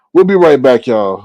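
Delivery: loud call to the viewers; loud sign-off for the break; announcing break. Real, not acted. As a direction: call it out to the audience.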